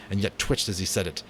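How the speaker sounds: usual speaking voice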